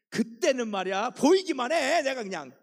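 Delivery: loud, high angry blast; mock rage from a wife fuming at her husband; mock furious outburst. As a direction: let the anger explode. Recalling fresh anger.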